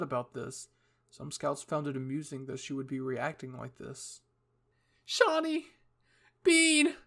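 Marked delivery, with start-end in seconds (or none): 5.0-7.0 high, loud anguished scream. Acted. Hanji mourning the dead Titans, screamed names. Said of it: "Hanji screamed once more".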